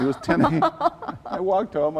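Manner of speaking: high laughing burst on ten a.m. Real, not acted. Guest laughing at his childhood self for the host.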